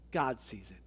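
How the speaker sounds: very low, soft, immovable non-answer; mock-cryptic. Pastor putting off a pleading visitor, mock-cryptic. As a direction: drop it low and soft, giving nothing away.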